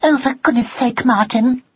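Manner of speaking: high, sharp exasperated mouse; sharp, high-pitched scolding; sharpest voice. A narrator's reading. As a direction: snap at him in a high voice. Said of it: "in her sharpest voice".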